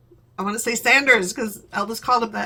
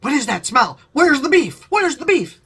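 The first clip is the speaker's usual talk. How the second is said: mock sniffing dad, louder on the last two lines; lightly voiced dad sniffing out beef; hound-dog dad; 3 semitones higher, 4 dB louder than usual. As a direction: ham up a hound dog hunting a smell. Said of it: "he's gonna be like a hound dog going".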